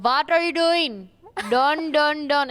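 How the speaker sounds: loud, high, slow mock Indian accent; hammed-up attempt at an Indian accent. Shown as a demonstration. Comedian showing her failed Indian accent, exaggerated and loud. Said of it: "if I try to do an Indian accent".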